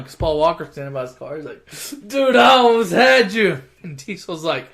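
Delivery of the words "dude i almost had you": loud, high, drawn-out imitated crowing; mock excited boast. Losing racer to the winner, loud excited mock boast. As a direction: crow it slowly as a mock brag.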